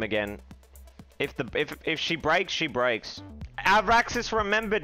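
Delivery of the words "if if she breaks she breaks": usual speaking voice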